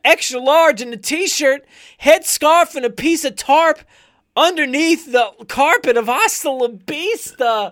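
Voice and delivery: high, slow, shouted rap lines. Retold or imitated. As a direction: yell it like a winded rapper. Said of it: "how much you sound like him".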